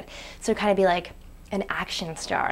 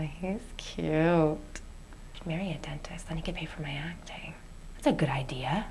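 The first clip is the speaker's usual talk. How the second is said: whispered musing; 3 semitones lower, 5 dB quieter than usual. Real, not acted. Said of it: "This meditation thing is really hard".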